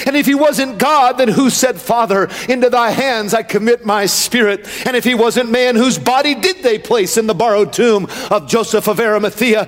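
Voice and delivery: impassioned pulpit delivery building to a high climax; fervent, rising sermon cadence; full-force preaching. Real, not acted. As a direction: preach it up to a ringing, high finish.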